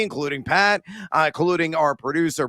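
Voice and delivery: ordinary talk, nothing marked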